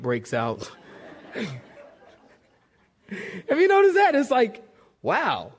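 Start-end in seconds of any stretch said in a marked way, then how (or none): 3.5-5.5 excited. Real, not acted. Preacher laughs at riots.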